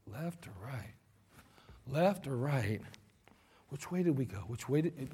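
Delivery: low, very soft puzzled murmur; lost muttering. Retold or imitated. Lost driver muttering to himself at a crossroads, very soft. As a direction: think aloud, lost and unsure.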